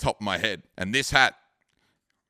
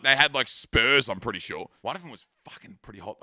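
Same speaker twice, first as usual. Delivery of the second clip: ordinary talk, nothing marked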